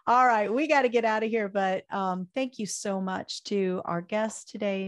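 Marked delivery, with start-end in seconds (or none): none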